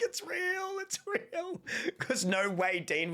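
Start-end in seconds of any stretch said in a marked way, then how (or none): 0.0-1.6 high, fading wail; mock anguish. Retelling Marcus learning the delay, high fading whimper. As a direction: whine it softer each time. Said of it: "He's like".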